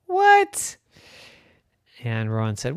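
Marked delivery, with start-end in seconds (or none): 0.1-0.8 very high startled squeak; a kid hearing bad news; shocked little unicorn. Rowan shocked.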